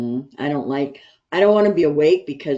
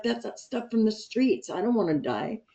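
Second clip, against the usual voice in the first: retold freak-out; 8 semitones higher than usual. Patient telling friends how she refused fentanyl, mock freaked-out. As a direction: act out freaking out, pitched well up. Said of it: "I freaked out".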